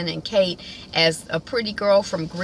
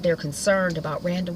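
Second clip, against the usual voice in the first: ordinary talk, nothing marked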